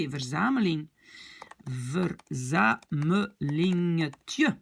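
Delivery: Verzamelingetje said very slowly, syllable by syllable; slow model word. Shown as a demonstration. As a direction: spell it out slowly with the voice. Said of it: "model word".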